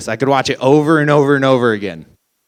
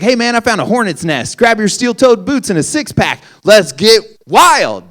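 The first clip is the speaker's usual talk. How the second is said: mock excited neighbor; 7 semitones higher than usual. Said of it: "You don't grab your neighbor like".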